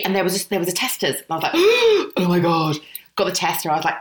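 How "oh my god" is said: gasp, then a drawn-out "oh my God"; acting out awestruck delight; thrilled gasp. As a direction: stretch it out after a big gasp. Acts out spotting the tester.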